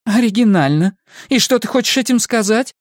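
high-pitched cross woman; high, indignant questioning; irritated challenge. A narrator's reading. Angry woman challenges his reply.

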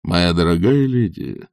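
slow, courtly actor's address; grand theatrical actor. A narrator's reading. Actor addressing the witch as a lady, grandly courtly. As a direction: draw it out with theatrical gallantry.

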